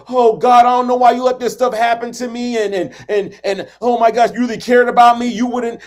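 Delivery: hammed-up victim grumbling at God; mock complaint to God. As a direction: shake your fist at heaven and whine. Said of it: "shaking your fist".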